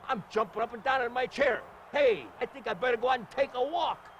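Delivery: excited ringside gushing; all excited. Real, not acted. Commentator jumping in his chair, all excited. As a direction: gush in short, punchy bursts of delight. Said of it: "I'm getting all excited".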